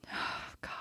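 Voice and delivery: horrified hushed whisper. Real, not acted. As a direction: breathe it out in dismay. Horrified at the memory.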